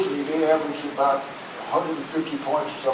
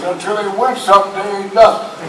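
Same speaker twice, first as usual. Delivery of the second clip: louder, higher imitation of Red; mock-gruff retold jab; retold loud putdown; 6 semitones higher, 9 dB louder than usual. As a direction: bark out Red's dismissal, half-joking.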